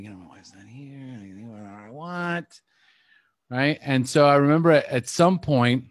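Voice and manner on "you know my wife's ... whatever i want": hushed, sneaky aside to oneself; sneaky glee. Retold or imitated. Husband alone with his snacks, sneaky and hushed. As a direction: drop right down to a furtive, soft voice. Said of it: "I'm getting away with something".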